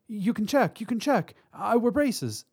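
high, anxious repeating; stammered. A narrator's reading. Hostage explains herself to robber, frightened stammer. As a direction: plead nervously to be believed. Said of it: "she stammered".

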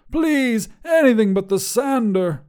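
high-pitched begging wizard; sniffling plea. A narrator's reading. Wizard begs to be spared the sander, whimpering high. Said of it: "Grandois sniffled".

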